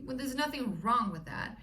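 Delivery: ordinary delivery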